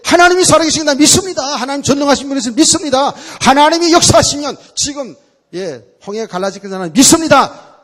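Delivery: loud, high, forceful praying; fervent prayer. Retold or imitated. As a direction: cry out to the Lord with all your might. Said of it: "막 힘차게 기도하고". (힘차게 외치는 기도)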